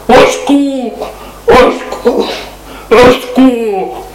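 high, drawn-out desperate cries; calling for help. Acted. Maurice alone and soaked, slow high cries for help. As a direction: stretch each cry slowly, afraid.

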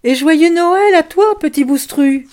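high, loud call toward the dark manor. Acted. Girl calling Christmas wishes to the lonely manor, high and loud. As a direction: shout the greeting across the distance. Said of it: "elle cria".

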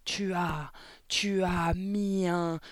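slow, sputtering build to a high shout; halting fury rising to a yell; vociferated accusation. A narrator's reading. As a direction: choke on outrage, then explode. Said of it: "vociféra mademoiselle Le Gourdin".

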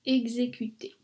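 ordinary delivery

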